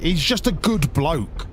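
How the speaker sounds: drawn out and deliberate, lingering on each word; slow it down to really mean it; slow emphatic praise. Real, not acted. Streamer praising Doctor Benji to chat, slow and sincere.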